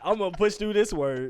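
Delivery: loud, high mock determination; imitating determined reader. Host voicing player facing a hard word, mock determined. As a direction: barrel into it like an overconfident reader. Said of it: "He was like".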